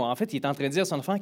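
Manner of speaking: usual speaking voice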